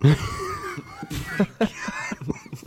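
high breathy laughing shout; burst of laughter. Real, not acted. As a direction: crack up hard at the joke. Laughs at a dark joke.

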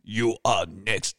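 low put-on movie villain threat; deep, hammed-up fighter impression; Bolo Young. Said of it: "Bolo Young, when you hear him speak".

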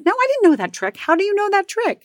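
very high, squeaky self-defense; indignant protest. Retold or imitated. Wife answering husband about the tire trick, high-pitched protest. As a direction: protest ignorance at the top of your range.

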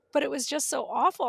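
ordinary delivery

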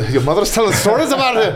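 high, laughing teasing line; laughing joke. Real, not acted. Cohost teasing his friend while laughing, high giggly.

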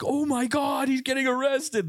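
high panicky friends' gasp; mock panic. Friends watching him get cuffed, high mock panic. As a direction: squeal it like scared kids running off.

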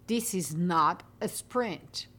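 slow, deliberate "this is not a sprint", leaning on "this"; emphasized phrase. Shown as a demonstration. Podcaster stressing a point to listeners, slow and emphatic. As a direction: press each word of "this is not a sprint", slowly. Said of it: "I am gonna emphasize on the word".

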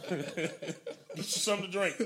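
slow laugh through the words. Real, not acted. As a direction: laugh slowly while repeating. Repeats quote laughing.

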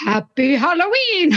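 slow jolly Santa voice. Acted. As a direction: say it like Santa Claus. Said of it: "you kinda sound like Santa".

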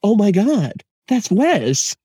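finally recognizing the actor mid-movie; jaw-drop oh my God; surprised recognition. Retold or imitated. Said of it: "it took me half that movie to realize".

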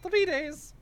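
high creature voice. Acted. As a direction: squeak it like the little critter. Fork answers in character.

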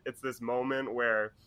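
ordinary delivery

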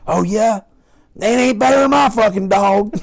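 hammed-up trucker trash talk over the radio; mock challenge. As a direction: pick a fight with a rival trucker. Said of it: "get on the line there and be like".

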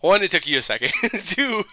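normal talking voice

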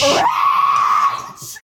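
shouted code word. Acted. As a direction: shout "Ranch!" back like a battle cry. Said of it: "with our code".

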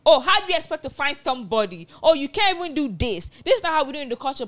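high, loud mocking scold; scolding elder aunty. Aunties scolding a grown niece, high and loud mocking scold. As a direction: berate her for not speaking Yoruba.

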